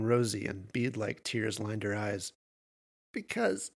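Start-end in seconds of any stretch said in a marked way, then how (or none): 3.1-3.7 high voice caught in giggles; choked mad chuckling. A narrator's reading. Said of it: "she choked through the mad chuckling".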